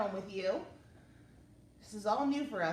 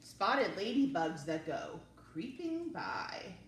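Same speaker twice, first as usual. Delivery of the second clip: lively, expressive read-aloud; reading to children. Acted. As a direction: read it to little kids with wonder. Reading picture book aloud.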